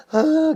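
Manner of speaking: a high mock sob of a grieving father; imitated sob. Teacher imitates a father's sob for the kids. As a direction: act out one quick cry for the children. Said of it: "nangis dia".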